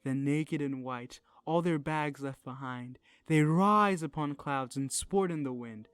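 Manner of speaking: steady, rhythmic reading of verse; poem recitation. Acted. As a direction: read it like a poem to the class. Recites Blake poem aloud.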